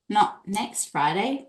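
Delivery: ordinary delivery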